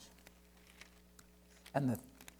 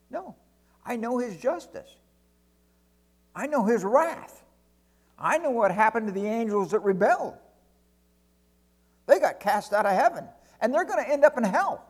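higher, emphatic protest from a mock angel; 6 semitones higher, 5 dB louder than usual. Angel objecting to God's plan for sinners, indignant. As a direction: argue it hard, pitch lifted. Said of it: "if angels talk like that".

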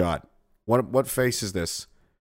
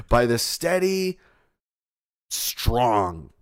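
sarcastic praise; 5 semitones higher, slower than usual. Pretend.

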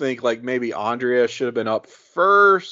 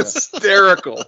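laughing; 8 semitones higher, 5 dB louder than usual. Real, not acted.

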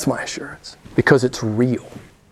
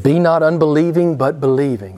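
loud charge, pressing "Be not unbelieving"; forceful command; 5 dB louder than usual. Retold or imitated. Jesus charges Thomas to believe, forceful. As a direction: command it loudly.

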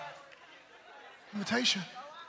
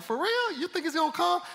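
high, teasing disbelief; a friend ribbing him, high and amused; mocking friend laughing; 10 semitones higher, 8 dB louder than usual. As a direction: scoff at the idea like a laughing friend. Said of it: "He was laughing".

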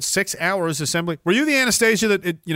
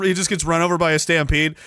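ordinary talk

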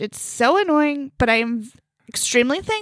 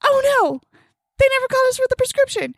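panicked; 11 semitones higher, faster than usual. Retold or imitated. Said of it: "I think".

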